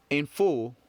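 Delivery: usual speaking voice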